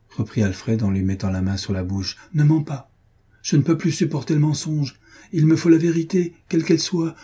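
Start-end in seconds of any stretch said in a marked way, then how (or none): 2.3-7.1 higher-pitched, agitated plea for truth; insistent demand. Acted. Husband silencing his friend's denial, urgent and indignant.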